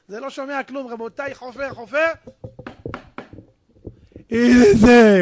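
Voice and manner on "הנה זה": very loud, drawn-out discovery cry; triumphant shout. Acted. Husband finally digs up the treasure, triumphant shout. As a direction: bellow it out in triumph.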